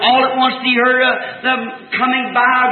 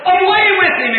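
mob hollering; 8 semitones higher, 3 dB louder than usual. Retold or imitated. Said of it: "They were hollering".

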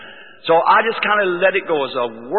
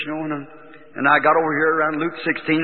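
ordinary delivery

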